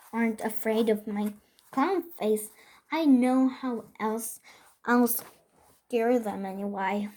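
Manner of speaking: plain reading voice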